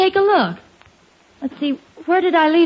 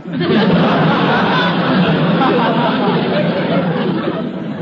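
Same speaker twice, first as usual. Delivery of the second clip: breathy helpless laugh; 3 semitones lower than usual. Real, not acted. Wife laughs at wool funnel.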